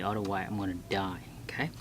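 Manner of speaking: quick joking whisper. Real, not acted. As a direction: whisper it fast as an aside. Joking aside about coffee.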